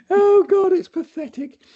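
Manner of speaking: high-pitched laugh through the words; real amused laugh breaking the words. Real, not acted. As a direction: laugh at your own silliness, real. Laughing at himself.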